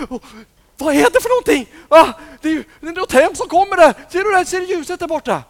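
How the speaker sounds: high, loud and fast scared voices; frightened disciples. Acted. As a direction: shriek out at the eerie light. Disciples see a frightening light.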